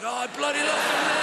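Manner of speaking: high-pitched mock craving cry; craving outburst. Addict gripped by Lilt cravings, high outburst. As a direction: burst out with desperate longing.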